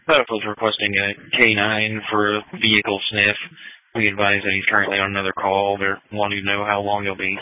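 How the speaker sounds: tinny radio dispatch; police radio. Real, not acted. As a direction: relay it over the radio.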